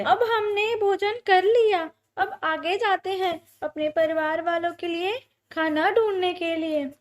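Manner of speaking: high, bright-pitched bird voice; purposeful little bird. A narrator's reading. Bird urging friend on to find family food, purposeful. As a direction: urge a friend onward in a high voice.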